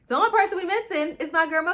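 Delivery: high, loud fangirl announcement; excited hype. Real, not acted. Fan announcing the favourite character, high excited hype. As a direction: sing out the name high and loud.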